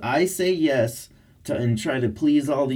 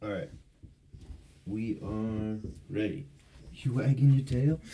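normal talking voice

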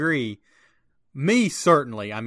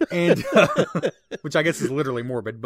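words through real laughter; laughing while talking; 4 dB louder than usual. Real, not acted. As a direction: still laughing, quick words. Laughing at his own morbid joke.

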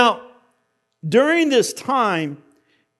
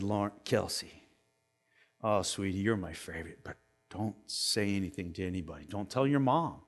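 put-on much softer, hushed secret to a child; secretive confiding; 12 semitones lower, 14 dB quieter than usual. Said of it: "and I said".

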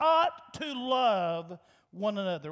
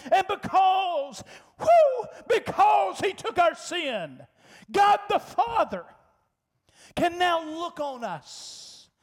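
loud, high cry of praise; preaching shout; 5 semitones higher, 4 dB louder than usual. Real, not acted. Preacher shouting to the congregation about sin. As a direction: lift it to a shout.

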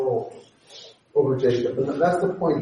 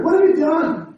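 high, louder accusing demand; accusatory; 11 semitones higher, 6 dB louder than usual. Retold or imitated. Laban confronts Jacob, accusing and raised. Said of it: "the first one is very accusatory".

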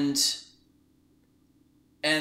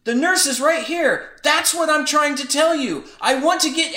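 belligerent and mean; 10 semitones higher, 7 dB louder than usual. Retold or imitated. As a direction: finally snap back at the caller. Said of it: "I had to be belligerent and mean".

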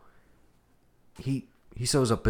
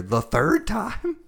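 slow, much higher, disbelieving; incredulous; 10 semitones higher, 3 dB louder, much slower than usual. Retold or imitated. Mocking the repeat court case to his cohost, incredulous. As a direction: stretch it out in mock shock.

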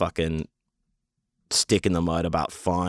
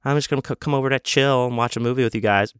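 lightly voiced casual visitor, higher pitch; Suze; 5 semitones higher than usual. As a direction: imitate her breezy greeting on arriving, pitched up. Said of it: "There she is, old Suze".

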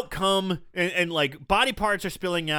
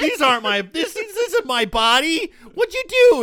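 rising into a high horrified squeal; a woman panicking at her new body, higher and louder; horrified outcry; 10 semitones higher, 3 dB louder than usual. Retold or imitated. Acting woman's horrified waking.

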